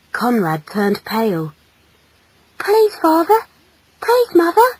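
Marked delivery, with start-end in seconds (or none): 2.6-4.7 put-on high, small boy's voice; anxious little son; pleading child.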